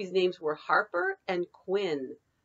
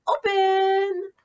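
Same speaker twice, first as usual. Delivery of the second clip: playful call; 13 semitones higher, 9 dB louder than usual. Acted. Children told to open eyes.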